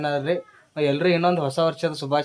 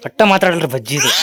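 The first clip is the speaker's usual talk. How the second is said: teasing exclaim; 5 dB louder than usual. Real, not acted. Teasing complaint about silent kid.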